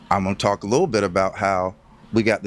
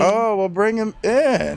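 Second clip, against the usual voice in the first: excited welcome; 13 semitones higher, slower than usual. Real, not acted. Grandfather walks into the interview.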